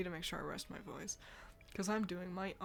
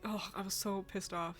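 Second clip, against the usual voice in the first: normal talking voice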